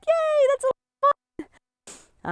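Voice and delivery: very high, squealing dog impression; gleeful dog voice. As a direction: voice a thrilled dog in a high mock cheer. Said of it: "And the dog's like".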